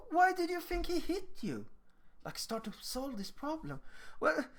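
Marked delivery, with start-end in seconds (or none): 0.1-1.7 put-on high-pitched coaxing question to a child; questioning adult. Adult starting to solve it with a child, coaxing question. 4.2-4.4 put-on high, halting child's excuse; defensive child. Child asked why he was hit, halting excuse. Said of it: "will they often say".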